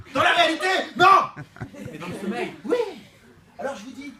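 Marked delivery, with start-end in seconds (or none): 0.1-2.8 high, loud comic yell; loud comic outburst; shouted punchline. Acted. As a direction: blast the punchline. Punchline contrast reality vs sleep.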